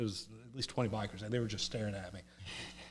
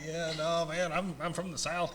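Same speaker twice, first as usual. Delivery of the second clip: lightly put-on Southern country voice, higher; higher-pitched retold country talk; 6 semitones higher, slower than usual. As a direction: lean into a country Southern accent. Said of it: "talking in my country voice".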